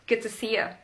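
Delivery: 'Good to see ya' as 'g'd tuh see ya'; casual linked 'Good to see ya'. Shown as a demonstration. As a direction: squeeze 'Good to see ya' into one chunk. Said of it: "It's faster".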